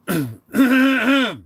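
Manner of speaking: long, loud, high throat clear; grandfather's throat clearing. Retold or imitated. Showing how grandfather always did it, exaggerated throat clearing. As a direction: clear the throat in a big drawn-out rumble. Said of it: "My grandfather always did".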